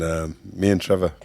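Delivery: ordinary delivery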